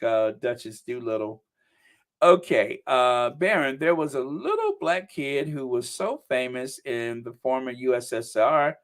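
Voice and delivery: ordinary delivery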